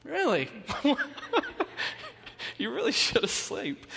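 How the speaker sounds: high laughing really; laughing reply. Real, not acted. Pastor laughing at the church lady's excitement, high and amused. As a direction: laugh through the teasing line.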